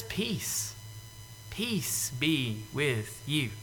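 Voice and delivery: soft, slow, tender blessing; calm them like frightened children; Jesus gently greeting the scared disciples; gentle peace. Retold or imitated. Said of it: "He just says".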